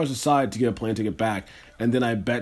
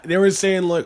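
normal talking voice

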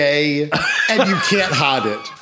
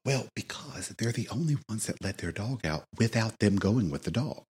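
hushed gossip, barely voiced; whispering; 6 semitones lower, 9 dB quieter than usual. Real, not acted. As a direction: lean in and whisper the dirt. Gossiping about downstairs neighbor.

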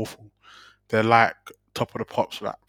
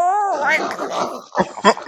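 laughing. Real, not acted. Laughs mid-rant.

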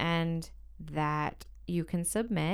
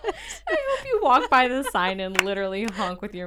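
laughing; 7 semitones higher, 8 dB louder than usual. Real, not acted. Laughing while proposing the challenge.

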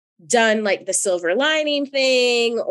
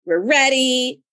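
ordinary talk, nothing marked

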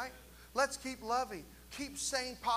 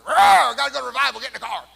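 put-on loud frustrated roar at the kids, higher pitch; mama yelling; 4 semitones higher, 16 dB louder, faster than usual. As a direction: bellow like a worn-out mom herding kids.

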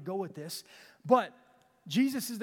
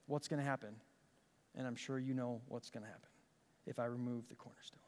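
hushed suspense; 7 semitones lower, 11 dB quieter than usual. Real, not acted. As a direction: drop to a near whisper before the pause. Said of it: "dramatic pause".

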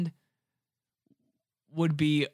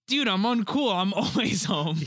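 lightly acted movie quote, higher and louder; raised-voice movie quote, half laughing; Philip Seymour Hoffman; 6 semitones higher, 3 dB louder than usual. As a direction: quote the line with a hint of character, breaking into a laugh. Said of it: "Philip Seymour Hoffman goes".

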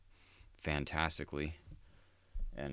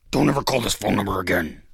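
much louder, dropping the act to a blunt order; loud punchline; 4 semitones higher, 12 dB louder than usual. Acted. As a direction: snap the trap shut, loud. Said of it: "Just fuck with them like that".

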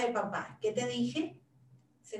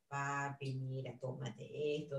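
softer, calm coaxing; calm with dad; 8 semitones lower, 11 dB quieter than usual. Retold or imitated. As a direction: gently talk dad into taking it. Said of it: "lo hablo con calma".

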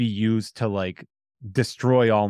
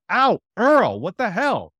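mock pained yelp; 11 semitones higher, 5 dB louder than usual. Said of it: "And she just goes".

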